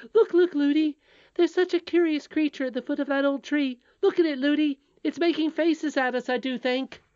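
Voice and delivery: put-on high, loud, eager child's voice; excited princess. A narrator's reading. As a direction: chatter excitedly as a little princess. Princess points out curious creature.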